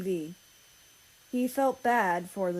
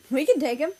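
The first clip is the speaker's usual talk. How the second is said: louder, higher eager offer; 7 semitones higher, 7 dB louder, much faster than usual. Acted. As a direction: jump in to claim the dog. Poland eagerly volunteers.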